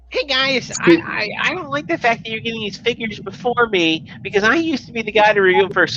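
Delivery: high whiny jealous voice; mocking impression. High whiny voice imitating a jealous rival reviewer. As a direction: whine it like a petty rival.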